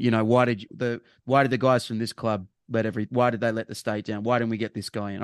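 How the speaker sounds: ordinary talk, nothing marked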